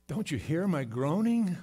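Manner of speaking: loud, rising anguished question; pleading cry. Acted. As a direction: cry out in complaint to heaven. Voicing a sufferer crying to God.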